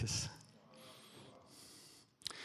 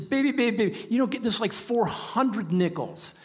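much higher, coaxing, slowing at the end; pleading dad; 11 semitones higher than usual. Retold or imitated. Father begging his daughter to take the twenty. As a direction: coax a crying child, exasperated.

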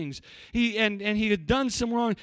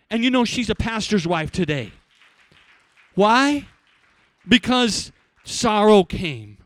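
preaching; 11 dB louder than usual. Real, not acted. Preaching climax.

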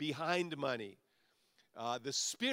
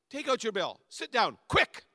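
higher and louder, rushing the debtor; urgent steward; 7 semitones higher, 8 dB louder than usual. Acted. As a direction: hurry him along, quick is a yelp. Steward urging debtor hurriedly.

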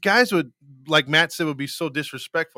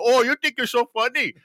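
higher-pitched taunt in put-on Indian accent; mock Indian accent; 7 semitones higher than usual. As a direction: imitate an Indian-accented clerk teasing a customer. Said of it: "the Indian one was from when I was in high school".

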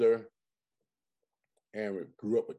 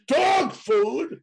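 loud, high-pitched comic disbelief; hammed-up indignant shout; mock outrage; 14 semitones higher, 6 dB louder than usual. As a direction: yell it like an appalled movie character. Co-host yells back movie line.

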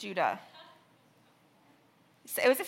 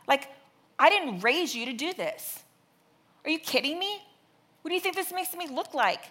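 condemning angry parent; 4 semitones higher, 7 dB louder than usual. Shown as a demonstration. Example of a condemning parent scolding his son, mock angry scolding. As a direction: demonstrate the wrong way, berating the child. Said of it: "that's condemning".

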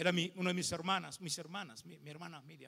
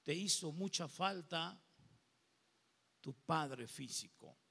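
God's gentle voice; 2 semitones lower, 7 dB quieter than usual. Retold or imitated. God speaking tenderly to the pastor about his father, soft. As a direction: speak it tenderly to a wounded son. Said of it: "Y Él me dijo".

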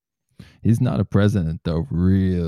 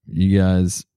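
usual speaking voice

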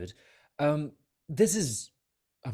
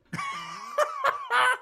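high squeaky laugh. Real, not acted. Laughs at speaking rat.

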